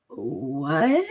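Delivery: low, soft drawn-out question; slow, incredulous low query; bewildered. Retold or imitated. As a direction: replay stunned confusion, starting deep and sliding up. Retells own bewildered reaction.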